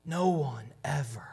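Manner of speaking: hushed, breathless whisper; awed whisper. Retold or imitated. Officer whispering his awe to the Pharisees. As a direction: whisper it in awe, barely voiced.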